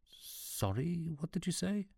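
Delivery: incredulous. Acted. Sean incredulous.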